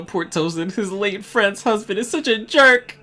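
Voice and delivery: mocking reread. Shown as a demonstration. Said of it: "repeats the caption mockingly".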